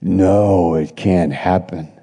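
low, hushed growling no; a sinister devil muttering refusal. Acted. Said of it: "And the devil is saying".

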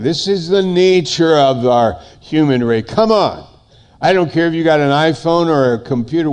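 high, loud, urgent sermon; impassioned preaching. Acted. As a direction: drive the indictment home from the pulpit. Preaches at full force.

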